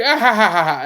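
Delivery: loud, hearty ha-ha laugh; mimicked open-throated cackle in a run of ha's; imitated laugh. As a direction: belt out someone else's big laugh. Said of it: "He's like".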